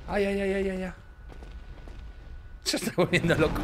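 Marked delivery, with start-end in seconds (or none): 0.1-0.9 playful yelps. Pretend. Playful yelping.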